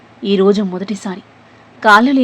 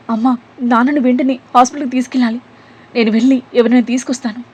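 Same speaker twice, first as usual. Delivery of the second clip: higher, louder, hurried urgency; urgent; 4 semitones higher, 3 dB louder than usual. A narrator's reading. Daughter urgently tells mother they need the hospital. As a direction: press the need to act now.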